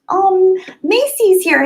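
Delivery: light up as a friend arrives; delighted greeting. Real, not acted. Streamer spotting a viewer joining, overjoyed.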